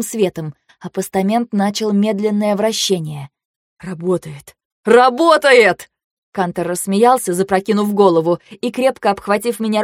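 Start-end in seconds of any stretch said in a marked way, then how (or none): 3.8-4.6 soft, barely voiced realisation; quiet dawning relief; hushed disbelief. A narrator's reading. First hushed realisation that it works. 4.8-5.9 loud, high, drawn-out cry; elated shout. A narrator's reading. Same word, now shouted in joy.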